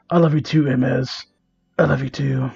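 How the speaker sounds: hammed-up tender whisper-soft; mock tender. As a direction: croon it like a cheesy lover. Tender fanfic line read.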